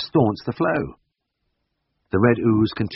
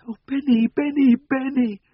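put-on high, wailing child's cry, slowing on the last Bennys; children crying out the dog's name, much higher; slower than usual. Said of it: "the wailing took on words".

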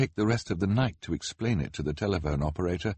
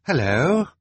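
calling hello; 8 semitones higher, 6 dB louder than usual. A narrator's reading. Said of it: "Violet said finally".

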